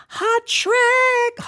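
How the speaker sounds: very high, loud drawn-out squeal; mock hype shout. Host announcing Brother Kerry's testimony, mock hype shout. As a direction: hype it like a hit record drop.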